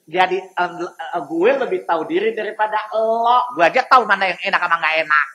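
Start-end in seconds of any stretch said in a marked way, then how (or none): none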